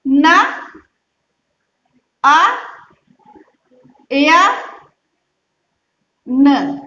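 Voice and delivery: न, अ, या, न spoken as separate sounds with long pauses; syllable by syllable. Shown as a demonstration. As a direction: break नयन into its sounds, one at a time. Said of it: "किस तरह से इसका उच्चारण करोगे".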